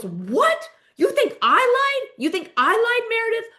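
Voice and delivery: very high, incredulous mock outburst; outraged disbelief. Heather accused of lying by Meredith, outraged and incredulous. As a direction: shoot the pitch up in affronted disbelief.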